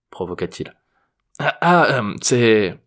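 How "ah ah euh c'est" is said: flustered stammering. A narrator's reading. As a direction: stumble over false starts and fillers. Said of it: "bafouilla Jérôme en réponse".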